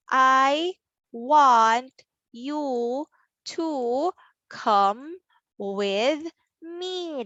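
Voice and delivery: slow, each word drawn out and separate; word-by-word reading. Shown as a demonstration. Teacher reads the sentence for children, word by word. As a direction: read it one word at a time. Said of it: "read the sentence".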